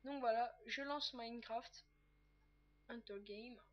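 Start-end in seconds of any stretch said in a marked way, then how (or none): 2.9-3.6 Hunter Game in a soft mock English accent; playful put-on English accent. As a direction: say the title like a jokey Englishman. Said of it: "Avec un magnifique accent anglais".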